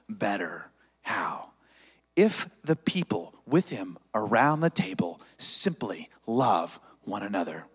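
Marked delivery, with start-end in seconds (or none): 0.1-1.5 whisper. Acted. Sermon line drops to whisper.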